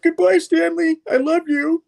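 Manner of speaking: very high, loud mock tearful goodbye; hammed-up farewell. Spider-Man bidding the goose farewell, high mock tearful cry. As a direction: wail it like a melodramatic parting.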